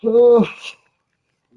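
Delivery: said through a long slow yawn. Real, not acted. Late-night streamer yawning through his words. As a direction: stretch the words out mid-yawn. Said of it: "so excuse me yawning".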